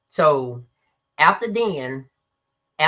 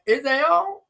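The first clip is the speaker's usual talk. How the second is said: put-on old father; 13 semitones higher, 5 dB louder than usual. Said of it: "He was like".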